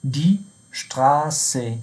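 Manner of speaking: شتراسه slowly, with a sharp SS; double S sound. Shown as a demonstration. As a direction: say دی شتراسه as German "die Straße".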